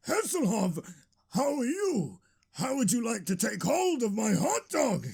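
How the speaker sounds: high, eager fan voice; starstruck greeting. Acted. Delighted hero offers his idol a hot dog, high and eager. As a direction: lift the pitch in delight at meeting your idol.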